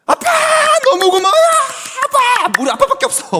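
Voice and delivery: very high, loud squeal of delight with a laugh; overjoyed little daughter. Retold or imitated. Daughter thanking dad for a five-thousand-won Daiso treat, squealing with delight. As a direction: gush thanks like a thrilled child who just got a gift. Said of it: "그대로 보여드릴게요".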